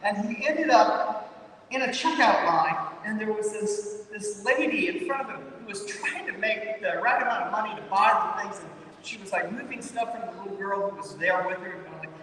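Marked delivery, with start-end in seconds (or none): none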